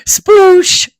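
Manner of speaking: ordinary delivery, nothing marked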